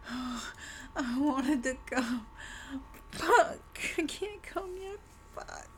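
high whimpering cry; whiny crying. Real, not acted. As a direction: whine it in frustrated despair. Desperate whining as the boss revives.

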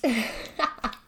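a bright burst of laughter; loud teasing laugh. Acted. After teasing question.

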